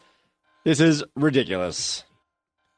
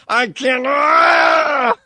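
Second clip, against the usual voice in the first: frustrated shout, higher and louder; angry yell; 8 semitones higher, 4 dB louder, much slower than usual. Acted. Ranting host lets out an angry shout. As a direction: let out a furious yell. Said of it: "I'm so angry right now".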